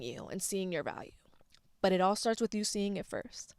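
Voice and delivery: hushed, intimate whisper; whispering it like a secret. Real, not acted. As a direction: drop to a whisper to land the point. Emphatic heartfelt closing point.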